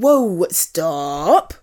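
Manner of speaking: normal talking voice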